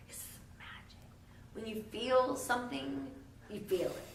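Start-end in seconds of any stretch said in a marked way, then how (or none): 0.1-1.0 breathy mystical whisper. Acted. Narrator whispers about love's magic. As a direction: hush it like a secret spell.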